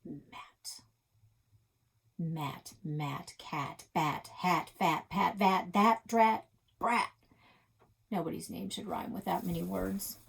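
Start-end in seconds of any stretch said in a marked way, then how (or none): none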